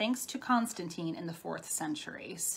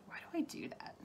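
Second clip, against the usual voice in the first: a hushed, higher inner voice asking itself; whispered self-question; 10 semitones higher, 12 dB quieter than usual. Retold or imitated. Talking to viewers about odd habits, a whispered higher question. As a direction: breathe the question as a private puzzled thought. Voicing her own puzzled thought.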